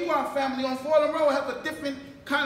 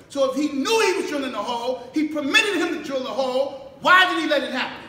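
preaching at full force; 3 semitones higher, 4 dB louder than usual. Acted. Minister challenging the congregation with rising, shouted preaching. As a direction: build the questions into a rousing shout.

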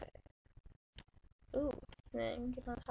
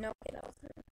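whispering; 10 dB quieter, much faster than usual. Real, not acted. Relieved under breath.

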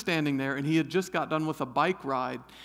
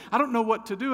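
ordinary talk, nothing marked

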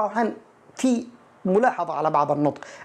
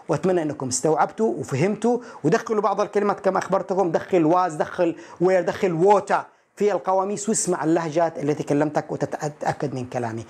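ordinary talk

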